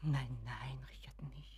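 soft breathy hush to calm him; barely voiced, anxious hush; hushed whisper. Mother hushing her son Richard, whispered.

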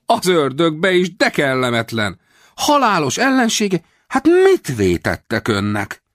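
high, slow hammed-up fret; staged dismay. A narrator's reading. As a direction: act shocked and worried. Rawlins feigning dismay. (eljátszott aggodalom)

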